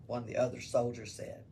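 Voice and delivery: plain reading voice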